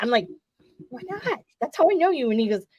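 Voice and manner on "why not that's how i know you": playful high tease. Retold or imitated. Fan teasing Marty.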